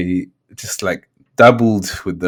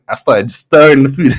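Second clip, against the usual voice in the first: high, loud, laughing retelling; tickled, voice climbing into a laugh; laughing while telling; 6 semitones higher, 6 dB louder than usual. Real, not acted. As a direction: break into laughter on the words. Cracking up telling the story.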